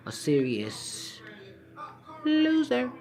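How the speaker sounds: slow, then a high mocking "loser"; taunting drawn out. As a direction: stretch out the taunt. Taunting TS over her loss.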